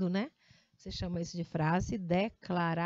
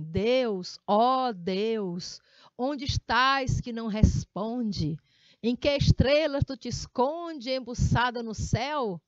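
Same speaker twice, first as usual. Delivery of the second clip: altissonância; emphatic recitation; 6 dB louder, slower than usual. Shown as a demonstration. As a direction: declaim the poem loud and slow with emotion. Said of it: "você vai ter que ler assim".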